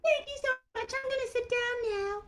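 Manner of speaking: high puppet voice; Mimi. Acted. Mimi says she likes the cow, high puppet voice. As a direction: pipe up sweetly as a tiny character.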